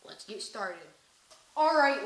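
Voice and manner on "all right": barked drill-instructor opener; loud coach call. Acted. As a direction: call the class to order, loud. Instructor yelling to start class.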